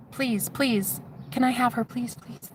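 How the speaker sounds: soft, innocent begging; small pleading voice; little girl pleading. Retold or imitated. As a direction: beg quietly, like a child. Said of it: "little girl in me".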